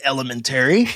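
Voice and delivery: "elementary" with every syllable sounded, ending "-terry"; un-American pronunciation. Retold or imitated. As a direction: mockingly echo "elementary" with a full "-tary". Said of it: "Americans don't say elementary".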